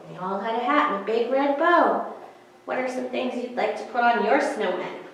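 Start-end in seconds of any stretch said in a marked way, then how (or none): none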